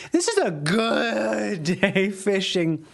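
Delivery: slow voice that sounds like a different person; slow, laughing voice that doesn't sound like him; odd put-on voice. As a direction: stretch it out like someone else is talking. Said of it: "I don't like the way that sounded".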